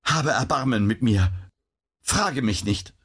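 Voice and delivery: halting plea for mercy; mildly faltering, imploring; stammering plea. A narrator's reading. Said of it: "stammelte sie".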